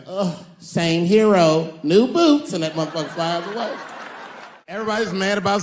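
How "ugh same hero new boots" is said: slow, sassy drawl that leaps way up on boots; sassy hero. Retold or imitated. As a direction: toss it off like a diva superhero. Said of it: "And he's like".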